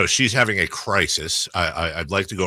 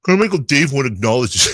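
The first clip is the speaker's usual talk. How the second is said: whiny voice; 5 semitones higher, 7 dB louder than usual. Pretend. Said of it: "I'm gonna read it in the whiny voice".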